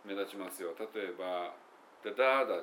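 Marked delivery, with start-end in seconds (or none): 2.0-2.6 quick da daa daa beat hummed; da daa daa sung on one syllable; rhythm hum. Shown as a demonstration. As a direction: hum the stress pattern fast. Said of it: "っていうやつね".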